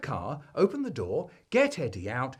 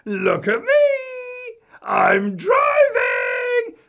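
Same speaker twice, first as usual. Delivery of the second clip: put-on very high, drawn-out child's yell; gleeful, stretched-out shout; 11 dB louder, slower than usual. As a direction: show off at full volume like a small boy. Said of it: "shouted out".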